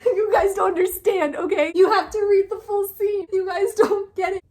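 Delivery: high, loud excited squeal of a fan; laughing, gushing. Real, not acted. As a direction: gush over the scene through laughter. Gushing over romantic scene.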